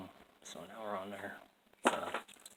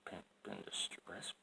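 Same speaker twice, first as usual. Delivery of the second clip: whispered repeat; 7 dB quieter than usual. Pretend. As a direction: breathe it out softly as an aside.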